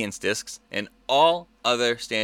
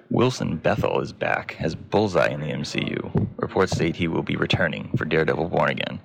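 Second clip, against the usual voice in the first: low, even newsreader voice; news read; 6 semitones lower than usual. Acted. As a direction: read the headlines like a news anchor.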